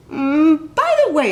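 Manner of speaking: high, loud, forced casual; a worried boy pretending to be offhand; nervous fake casual. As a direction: slip it in nervously. Ira nervously asking.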